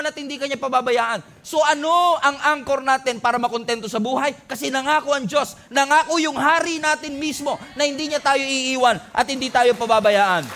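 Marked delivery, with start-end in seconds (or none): 1.5-10.4 preaching at full force. Acted.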